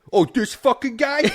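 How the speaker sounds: high, loud mock New York complaint; exasperated New Yorker, hammed up; New Yorker accent. Host demonstrating a New Yorker, shouted mock New York accent. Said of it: "proper New Yorker accent".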